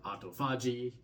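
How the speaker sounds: AUTOPHAGY sounded out as AH-toe-FAH-jee; trial pronunciation. Shown as a demonstration. Presenter puzzles over saying the word, autophagy tried as AH-toe-FAH-jee. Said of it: "understanding how to even pronounce this word".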